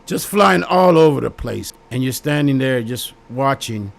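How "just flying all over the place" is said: louder and higher, driving it home; emphatic raised voice. Real, not acted. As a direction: build it up big, almost shouting. Dramatic scene of flying lies.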